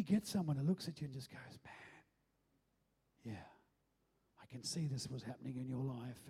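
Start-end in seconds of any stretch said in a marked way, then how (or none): 1.6-6.3 hushed, low understanding voice; soft and low, as a kind confidant would; acted compassion. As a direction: start near a whisper, gently understanding. Acts out a compassionate confessor.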